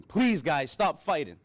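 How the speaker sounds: put-on a child begging his grandmother and her man to stop, loud; retold scream. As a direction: cry out for them to stop, like a scared child. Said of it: "all I could do was scream".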